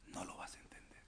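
unvoiced whisper of the line; whispered warning. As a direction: lean in and whisper it.

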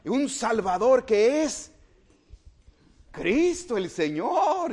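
slow, grand herald's announcement; majestic proclamation. Retold or imitated. Preacher voicing the angel's announcement, slow and majestic. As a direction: proclaim it with great pomp. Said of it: "con gran majestuosidad".

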